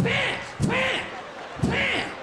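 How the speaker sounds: high, shouted bam blast; a sudden explosion yelled out. Retold or imitated. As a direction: slam the word out like a blast. Mimics violent toilet blast.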